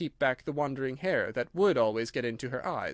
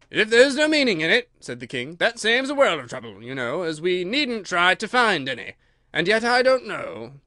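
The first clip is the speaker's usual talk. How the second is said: higher, louder fussy king; pompous king; 4 semitones higher, 6 dB louder than usual. Acted. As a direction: fuss over it like a vain king. King pondering the verses.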